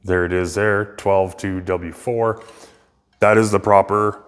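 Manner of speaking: ordinary talk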